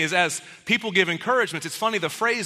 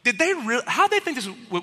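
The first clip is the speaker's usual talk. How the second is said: usual speaking voice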